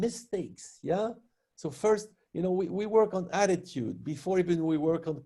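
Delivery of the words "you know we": ordinary delivery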